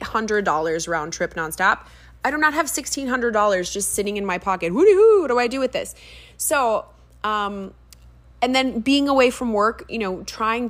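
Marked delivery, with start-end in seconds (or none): 4.7-5.9 high, loud, quick mock whoop; hammed-up, high-pitched fake excitement; mock glee. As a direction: pretend to be giddy with spare cash. Mock glee over imaginary spare money.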